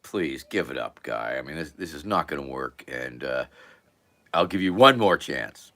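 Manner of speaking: tag weary dismissal. Acted. Bartender wearily dismisses the act.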